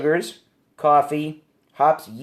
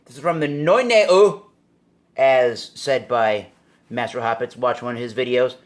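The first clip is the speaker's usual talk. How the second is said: ordinary talk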